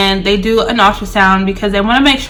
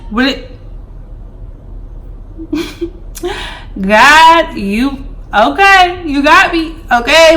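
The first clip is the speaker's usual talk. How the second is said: stunned disbelief; 5 semitones higher, slower than usual. Real, not acted. Shock at twins.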